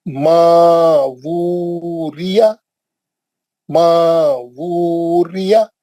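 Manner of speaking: slow, drawn-out Quranic recitation. Shown as a demonstration. Teacher recites word slowly for class. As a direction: chant each syllable slowly for students. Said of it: "उसके बाद".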